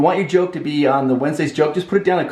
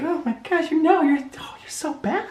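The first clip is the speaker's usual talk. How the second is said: much higher, soft, coy playful voice; giggly tease, pitched way up like his wife; flirty wife voice; 14 semitones higher, 4 dB quieter than usual. Retold or imitated. Husband imitating his wife's coy reply to his come-on, high and soft. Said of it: "And she'd be like".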